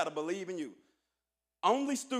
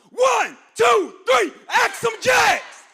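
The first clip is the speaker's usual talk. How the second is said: very high, very loud count-in and slow shouted motto; rally the crowd with a huge count-in shout; count-in cheer; 13 semitones higher, 16 dB louder, much slower than usual. Real, not acted. Speaker leading the freshmen in the school cheer, huge shout. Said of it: "Ask 'em Jacks on three".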